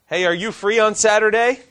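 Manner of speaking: a friend fishing for help before naming the favor; friend asking a favor. Retold or imitated. Friend asking open-ended favor, voiced.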